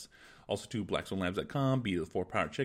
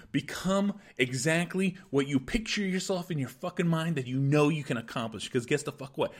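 angry motivational rant; 3 semitones higher than usual. Acted. Podcast host firing up his listeners, fierce angry pep talk. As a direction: fire them up like a furious coach.

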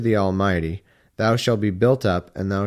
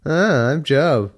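slow, higher dopey mock voice; a lightly done dumb Job voice; 4 semitones higher, 3 dB louder, slower than usual. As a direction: drag it out like a kid teasing. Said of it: "like when you're a kid".

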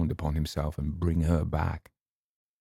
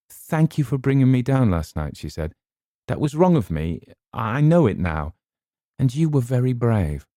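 put-on higher, louder young woman's voice; Eleanor, grateful; 7 semitones higher, 6 dB louder than usual. A narrator's reading. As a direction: thank him warmly and earnestly.

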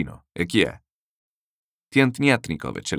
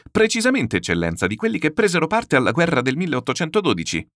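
eager; 9 semitones higher, 4 dB louder than usual. Acted. Cicikov eagerly confirms to general.

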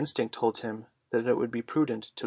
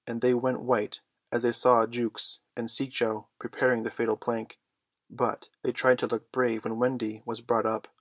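plain reading voice

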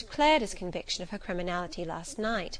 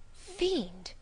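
indignant exclamation; 9 semitones higher, 4 dB quieter than usual. A narrator's reading. Reacting to the servant's prying, indignant high exclamation.

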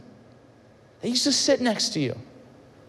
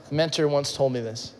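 normal talking voice

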